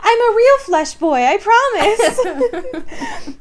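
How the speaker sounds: put-on very high, drawn-out pleading baby voice; creepy baby doll. Host voices the meme CGI baby pleading, high and slow. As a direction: stretch out a high, laughing plea. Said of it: "joke voice of the baby".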